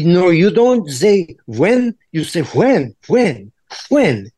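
put-on slow, deliberate Eastern European accent; drawn-out Eastern European accent. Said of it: "they say".

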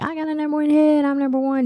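high, sustained mock-celebration voice; imagined boasting. Imagining the singer celebrating his hit, high mock boasting. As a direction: crow about it in a high voice.

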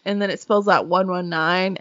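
normal talking voice

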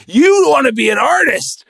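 high, loud, slow indignant shouting; mock outrage. Elders scolding a son who won't be a stockbroker, shouting. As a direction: shout it like an appalled rich elder. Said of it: "They're like".

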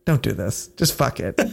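lazy inner voice. Retold or imitated. The voice in his head telling him to quit, low and soft. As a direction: lightly voice the lazy voice in your head. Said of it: "there was a voice in my head".

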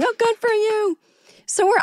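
very high, cooing congratulation; mock-gushing well-wisher; imitating applauding passengers. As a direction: coo it in a high crowd voice. Mimics applauding fellow passengers.

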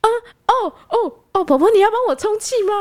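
high, taken-aback stammer; startled. Retold or imitated. Surprised driver asking the tiny granny to pump the tyre, startled high voice. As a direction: stumble over the words, caught off guard. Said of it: "我就有点吓到".

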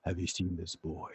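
very low, soft menacing voice; hushed, deep villain impression; dramatic robot villain. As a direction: drop it low and quiet like a movie cyborg. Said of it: "you're expecting him to sound like".

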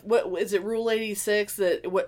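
ordinary delivery

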